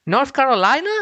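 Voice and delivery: really can't believe it; incredulous. Real, not acted. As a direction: pitch jumps way up on the question. Disbelieving question.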